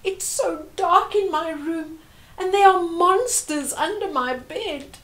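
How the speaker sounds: put-on a child telling mum about monsters; scared girl calling out in bed; frightened child. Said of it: "Sanele cried to her mother".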